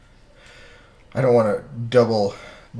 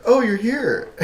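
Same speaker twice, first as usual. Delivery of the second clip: high-pitched imitated surprise; mimicking a startled booth visitor; surprised greeting; 12 semitones higher, 4 dB louder, slower than usual. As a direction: exclaim it high, falling at the end.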